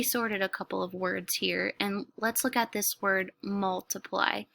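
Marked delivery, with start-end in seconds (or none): none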